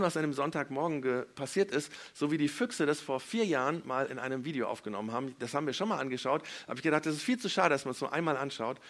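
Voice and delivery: ordinary talk, nothing marked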